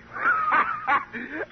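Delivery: a very high squealing laugh; shrill laugh. Acted. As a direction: squeal with laughter at your own gag. Comedian laughing at own joke.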